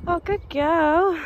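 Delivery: high, slow sing-song praise for the dog. Real, not acted. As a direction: coo it to a good dog. Praising her dog on the walk.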